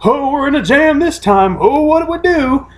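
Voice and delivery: high, fast hammed-up worried old buddies; old buddies in a jam. Two grizzled slayers trapped in the fort, hammed-up high worry. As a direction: ham up two grizzled dudes doomed in the fort. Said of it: "they're sitting there like".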